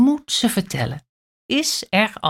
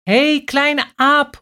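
higher, louder call from the tree; 8 semitones higher, 4 dB louder than usual. A narrator's reading. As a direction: call to her child from above. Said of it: "roept mama aap haar toe". (mama aap roept luid vanuit de boom)

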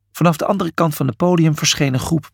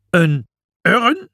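high, rising, appalled repetition; disgusted outcry; 9 semitones higher, much slower than usual. A narrator's reading. Imhotep appalled at the word for the crown, disgusted. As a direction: exclaim in disgust, climbing higher. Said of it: "schreeuwde Imhotep vol afkeer".

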